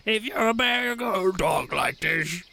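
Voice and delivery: growly mock bear talk; bear voice impression. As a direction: imitate someone demonstrating a bear's voice. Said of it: "remember she was like".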